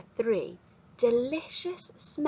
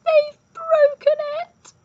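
very high, loud squealed complaint; squeaky baby bear; 10 dB louder than usual. A narrator's reading. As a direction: wail it in a tiny cub's squeak. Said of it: "squeaked Baby Bear".

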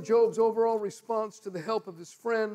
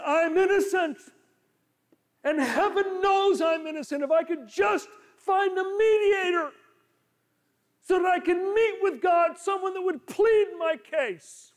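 desperate Job; 8 semitones higher, 5 dB louder than usual. Retold or imitated. Preacher voicing Job's desperate cry.

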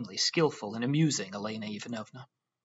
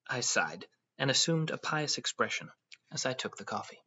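plain reading voice

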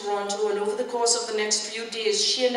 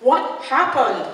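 higher, louder, urgent question; impatient, raised-voice demand; insistent demand; 5 semitones higher, 7 dB louder than usual. A narrator's reading. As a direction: press hard for the answer. Daughter demands again, cutting through stalling.

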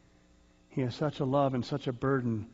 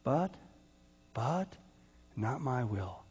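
hushed prayer; 3 semitones lower, 6 dB quieter than usual. Retold or imitated. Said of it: "who said what?".

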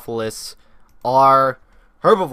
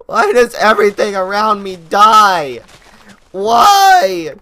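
louder hammed-up wail of despair; mock despair; 4 semitones higher, 9 dB louder, slower than usual. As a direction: overact the grief to the sky. Pet dinosaur died.

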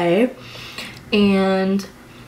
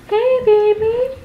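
high, slow cooing at the dog; doting pet voice, much higher and slow; 3 dB louder, slower than usual. Real, not acted. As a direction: coo sweetly to your puppy.

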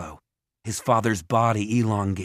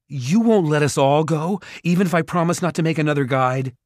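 higher and louder, speeding up; raised, anxious questioning; tense plea; 6 semitones higher, 5 dB louder than usual. A narrator's reading. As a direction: bargain urgently with a captor. Jared bargains with the ogre.

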